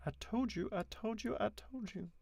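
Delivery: high repeated phrase; voice warm-up. Shown as a demonstration. Reader warming up on the Count's line, pitched high. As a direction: run the line up high in an altered voice. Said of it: "There we go".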